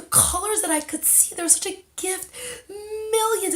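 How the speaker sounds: high, voice breaking with tears; choked up with gratitude; tearful. Real, not acted. Thanking God for colors, close to tears. Overcome while giving thanks.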